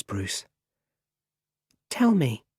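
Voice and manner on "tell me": high, lightly voiced child; little boy. A narrator's reading. Boy in bed asking the butler, childlike and high. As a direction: ask it as a small boy, pitched up.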